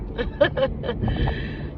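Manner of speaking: short airy laugh at a hater; breathy chuckle; amused laugh. Real, not acted.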